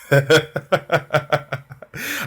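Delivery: long high chuckling laugh; real laughter. Real, not acted. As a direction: laugh freely, rising at the end. Joking with a viewer.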